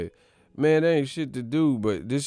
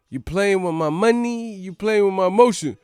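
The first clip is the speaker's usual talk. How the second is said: Big Worm; 7 semitones higher, 4 dB louder than usual. Acted. Movie gangster confronting someone over money, high-pitched taunt. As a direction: quote the movie gangster in a raised, lilting voice. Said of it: "Remember when Big Worm".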